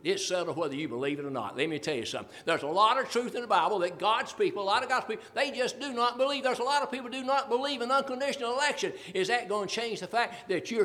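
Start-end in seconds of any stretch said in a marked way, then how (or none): none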